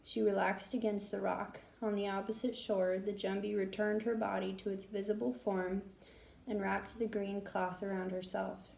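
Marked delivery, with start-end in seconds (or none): none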